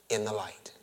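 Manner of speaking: breathy hushed ending; whisper. Acted. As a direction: let it fall away to a whisper. Sermon fades to intimate close.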